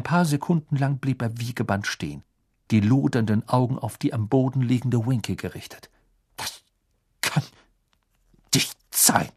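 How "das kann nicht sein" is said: choppy. Acted. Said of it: "stieß er abgehackt hervor".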